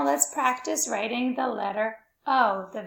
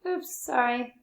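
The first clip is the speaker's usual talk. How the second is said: usual speaking voice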